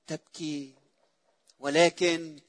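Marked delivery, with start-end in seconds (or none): 0.1-0.7 hushed tender. Acted. Jesus's words, hushed.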